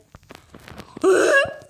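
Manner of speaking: loud rising gasp of shock; sharp high gasp. Real, not acted. Gamer stunned by the game, loud gasp. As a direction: gasp in real surprise.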